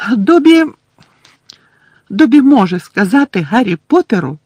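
high, loud squeaky elf voice; bold house-elf. Acted. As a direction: squeak it out bravely, chest puffed. Elf bravely declares. (сміливий писклявий вигук ельфа)

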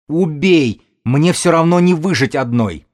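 high, loud despairing yell; desperate scream. A narrator's reading. Widow begs to be killed, screaming in despair. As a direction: cry out with nothing left to live for. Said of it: "заорала беременная".

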